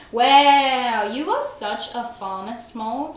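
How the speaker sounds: loud mock-scandalised "Wow"; mock shock. Streamer teasing a chatter, mock scandalised. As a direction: act outraged at a chat joke. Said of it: "That's insane behavior".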